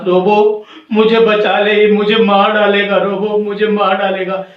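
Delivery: loud acted cry for help; urgent, frightened storybook yelling; panicked plea. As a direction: beg to be saved without pausing. Bird begs robot for rescue.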